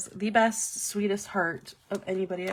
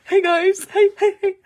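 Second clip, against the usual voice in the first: very high, loud, eager greeting; excited sideline player; 11 semitones higher, 10 dB louder than usual. Acted. Quarterback hailing his teammates on the sidelines, hammed-up excitement. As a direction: call out like a golden retriever greeting everyone. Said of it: "he's, like, on the sidelines".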